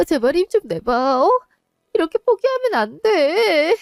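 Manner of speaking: very high, pleading cry; desperate plea. Acted. Daughter begs her dying mother to hold on, high plea. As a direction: beg her not to give up.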